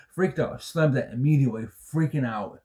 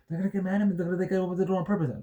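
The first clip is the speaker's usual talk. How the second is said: imitating his own panicked thoughts, higher and fast; retold panic; 8 semitones higher, much faster than usual. Retelling his panic in the van, higher and fast. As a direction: rush it out like a panicking passenger afraid of blame. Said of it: "I was nervous and I was panicking".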